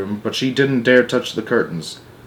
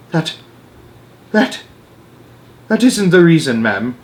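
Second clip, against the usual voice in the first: quivering; 6 semitones higher, 4 dB louder than usual. Acted. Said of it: "The maid's voice was quivering".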